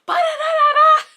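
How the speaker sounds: high loud hype-man chant; shouted hype syllables. Pretend. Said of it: "I need a hype person".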